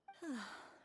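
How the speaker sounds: put-on long wistful sigh over old memories; sad sigh. Mother recalls the boys' quarrel.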